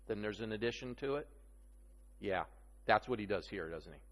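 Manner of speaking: usual speaking voice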